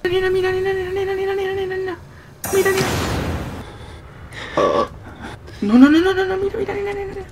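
high cooing call to a cat. Real, not acted. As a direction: coo to the kitty. Calling the cat sweetly.

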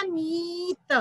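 मीत with a long ee and detached त; long vowel. Shown as a demonstration. Teacher answering what new word was made, drawn-out मीत. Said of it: "नया शब्द क्या बना?".

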